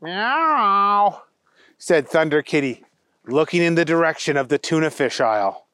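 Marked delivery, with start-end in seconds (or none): 0.0-1.2 high mewing kitten voice; cat meow. Acted. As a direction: mew it like a small cat. Cat signals the agent.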